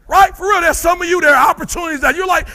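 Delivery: high, loud shouted sermon; preaching at full force. Acted. Speaker calling out the crowd's excuses, shouting high. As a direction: preach it hard at them.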